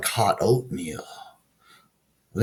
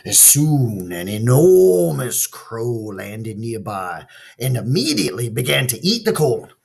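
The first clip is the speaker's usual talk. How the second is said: ordinary delivery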